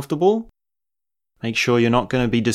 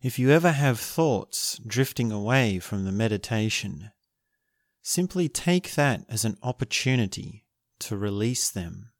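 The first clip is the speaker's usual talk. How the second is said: guided meditation; 5 dB quieter than usual. Acted. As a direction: guide them gently into stillness.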